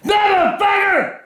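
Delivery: drawn-out angry yell at the game; furious shout. Real, not acted. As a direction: roar it out in real rage. Dad raging at phone game.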